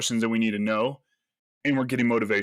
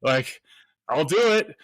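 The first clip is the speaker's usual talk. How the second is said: dismayed surprise; 2 semitones higher, slower than usual. Retold or imitated. Told yelling was his normal voice.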